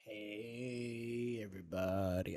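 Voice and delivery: put-on long drawn-out drunken greeting, sinking low; drunk Calm. Mimicking a drunk friend joining the call, slurry drawl. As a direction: imitate a drunk dragging out his words. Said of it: "when he's drunk, he doesn't shut up".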